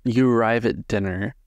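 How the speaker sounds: ordinary delivery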